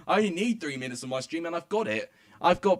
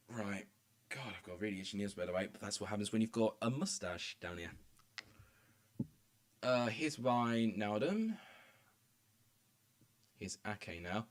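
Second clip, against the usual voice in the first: much softer, low-key aside; soft murmur while taking a drink break; resting voice; 6 semitones lower, 11 dB quieter than usual. Real, not acted. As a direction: talk quietly to rest the voice. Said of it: "time to give my voice a break".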